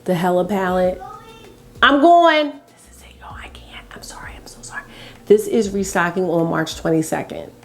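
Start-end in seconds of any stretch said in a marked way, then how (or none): 1.8-2.5 higher, louder call back. Real, not acted. Vlogger answering someone rushing her off camera, calling out. 2.5-4.8 very soft, hushed apology; furtive whisper. Real, not acted. Vlogger sneaking an apology to viewers, furtive whisper. Hurried aside to viewers while rushed.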